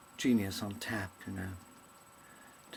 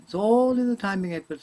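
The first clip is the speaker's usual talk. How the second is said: high, loud punchy delivery; comedian's punchline; 11 semitones higher, 12 dB louder than usual. Retold or imitated. Loud punchy comedian's line, high, to the young actor Edward. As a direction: punch it like a seasoned comic. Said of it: "a great comedian said this to me".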